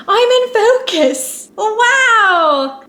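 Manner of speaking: high, drawn-out squeal of delight; real delighted high-pitched outburst; excited. Real, not acted. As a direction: exclaim it high and stretched, thrilled. Excited at in-focus shot.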